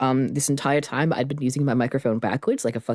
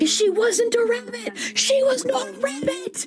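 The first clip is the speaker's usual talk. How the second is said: high squeaky voice; slower than usual. Acted.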